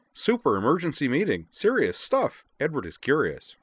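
high, loud, excitable character voice; Edward. A narrator's reading. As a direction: punch out each phrase in a high pipe. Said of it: "declared in a high voice".

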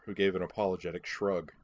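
ordinary delivery

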